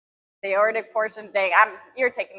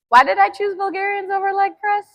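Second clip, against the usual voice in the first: straining mid-set; 10 semitones higher, 5 dB louder than usual. Real, not acted. Groaning mid-set at the gym.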